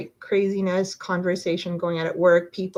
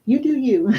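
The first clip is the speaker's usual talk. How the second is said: a sassy, louder "you do you"; 5 semitones higher, 6 dB louder than usual. Real, not acted. Reader teasing the sitter about walking out, sassy. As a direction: toss it off with attitude, pitched up. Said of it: "I don't know why I'm talking like that".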